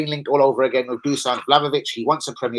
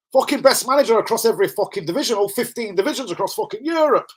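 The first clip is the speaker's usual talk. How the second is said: mock tirade; 7 semitones higher than usual. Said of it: "then it was just a tirade of".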